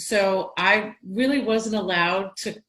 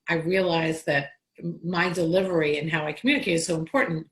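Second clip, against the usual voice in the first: normal talking voice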